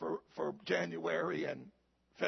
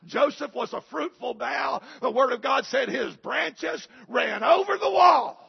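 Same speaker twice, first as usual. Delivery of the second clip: loud, high preacher's proclamation; preaching at full force; 6 semitones higher, 9 dB louder than usual. Acted. Pastor opening his sermon text, preaching at full force. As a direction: ring it out over the congregation.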